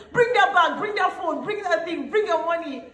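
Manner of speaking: high, loud imitation of robbers; shouted demands. Robbers demanding bag, phone and money, shouted. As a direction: fire off orders, high and shouted.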